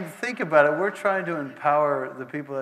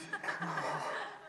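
laughter; 5 semitones higher, 7 dB quieter than usual. Real, not acted. Laughs at the story.

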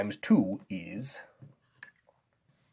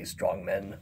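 usual speaking voice

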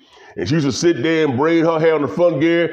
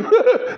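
squealing laugh; high cackle. Real, not acted. Laughing fit.

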